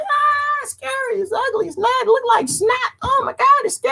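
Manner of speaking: very high squealing fake panic; hammed-up falsetto fear; mock fright. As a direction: squeal it like a terrified ninny. Mimics people scared by virus picture.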